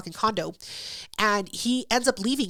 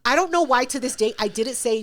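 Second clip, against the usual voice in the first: ordinary talk, nothing marked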